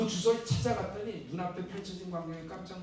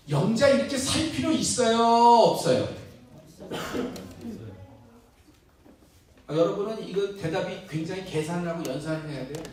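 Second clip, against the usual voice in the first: louder rousing sermon voice; preaching; 4 semitones higher, 8 dB louder than usual. Real, not acted. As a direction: challenge the congregation from the pulpit. Pastor challenges congregation. (힘차게 외치는 설교 톤)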